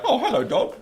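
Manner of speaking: high sing-song hello; cheery dog greeting. Retold or imitated. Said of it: "you're like".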